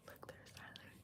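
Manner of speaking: usual speaking voice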